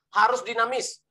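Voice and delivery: normal talking voice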